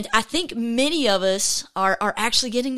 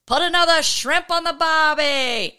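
bad Australian accent, a bit higher; self-mocking attempt at an Australian accent; 6 semitones higher than usual. Shown as a demonstration. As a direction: ham up a tourist's Australian accent. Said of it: "the only thing I can do in Australian".

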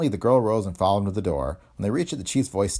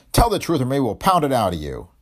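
loud, raised-pitch police threat; angry threat; 6 semitones higher, 6 dB louder than usual. Acted. Police chief threatening to pound it out, angrily. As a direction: lean hard on the suspect.

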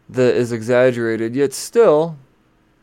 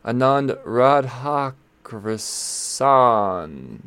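slowly sounding out Anand Radhakrishnan, drawing out each syllable; very slow, effortful pronunciation; careful name attempt; much slower than usual. Real, not acted. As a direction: stretch the name out as you work through it. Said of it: "I gotta say this name again".

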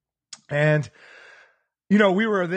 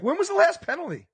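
higher, louder mock kid asking; imitating a kid; 11 semitones higher, 4 dB louder than usual. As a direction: voice the puzzled thirteen-year-old, pitched up. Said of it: "a little kid, thirteen-year-old was like".

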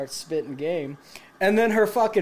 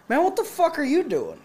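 higher-pitched mock disbelief; incredulous challenge; 10 semitones higher than usual. Teller to a stranger in the beer line, incredulous. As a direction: challenge him as if you can't believe it.

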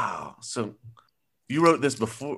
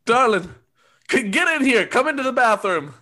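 calling out; 10 semitones higher, 5 dB louder than usual. Acted. Calls wife into bathroom.

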